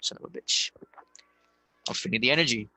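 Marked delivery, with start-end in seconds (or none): 0.0-0.7 whispered, holding back tears; barely voiced, fighting not to cry; real emotion breaking through in a whisper; choked up. Real, not acted. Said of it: "I got that out of my system".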